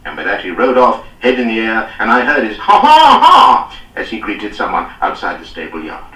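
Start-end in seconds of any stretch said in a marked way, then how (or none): 2.6-3.7 high, loud braying ha ha ha; haughty lord's braying laugh; mocking laugh. A narrator's reading. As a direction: bray it out in scorn.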